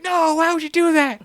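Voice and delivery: very high hammed-up dying wail. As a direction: ham up the wounded man's protest. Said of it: "He's like".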